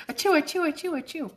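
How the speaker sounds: high fake sneezes, playful; mock sneezes. Joking to viewers about sneezing, mock high sneezes. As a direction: act out a string of silly sneezes.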